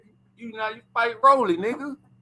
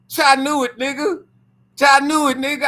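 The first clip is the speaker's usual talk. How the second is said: loud, high triumphant outburst; I knew it; 8 semitones higher, 5 dB louder than usual. Real, not acted. Him hearing the Pacquiao news, loud triumphant gloat. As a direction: shout it like you called it.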